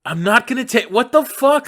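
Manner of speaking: high, loud indignant outburst. Real, not acted. Guest refusing the host's request for couch photos, indignant. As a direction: object loudly, half laughing.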